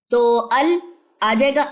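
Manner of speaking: ordinary talk, nothing marked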